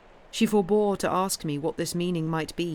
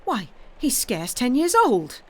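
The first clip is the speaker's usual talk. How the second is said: high, loud distressed cry; 6 semitones higher, 6 dB louder than usual. Acted. Housekeeper protesting the cruel charge against the boy, crying out loud. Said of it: "cried Missus Groves".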